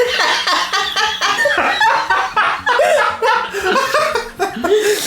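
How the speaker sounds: long high-pitched laughing fit. Real, not acted. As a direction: cackle up high. Laughing after the song.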